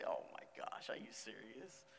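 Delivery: hushed, breathless whisper of disbelief; a startled whisper to God; shocked whisper. Retold or imitated. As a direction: whisper it like you can't believe it. Startled reply to the Holy Spirit.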